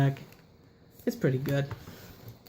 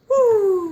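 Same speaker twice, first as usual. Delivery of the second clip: piercing high bird screech; shrill screeching bird woman; 8 dB louder than usual. Pretend. Apex Avian bird screech.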